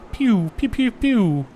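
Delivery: high, loud pew pew rifle noises; mock gunshots. Streamer imagining sniping from the hill, high loud pew noises. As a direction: fire imaginary sniper shots with your mouth. Mimicking sniper rifle shots.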